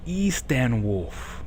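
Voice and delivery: "Easton Wolf" drawn out slowly with a long E; slow, deliberate Easton Wolf. Shown as a demonstration. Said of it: "it's probably just gonna be Easton Wolf".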